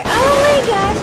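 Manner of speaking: high, loud thrilled cry; excited squeal. Real, not acted. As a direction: shriek with delight. Excited reaction after singing.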